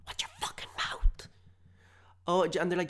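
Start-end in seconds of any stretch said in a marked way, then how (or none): 0.1-1.3 high, strained whispered threat; furious whisper. Acted. Customer threatening the cashier, fierce whisper. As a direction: whisper it fiercely, barely voiced.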